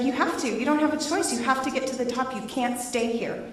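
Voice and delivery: lightly voiced insistent husband; firm husband. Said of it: "he's like".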